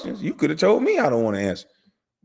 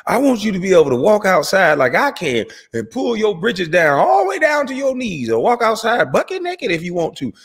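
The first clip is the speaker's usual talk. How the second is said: usual speaking voice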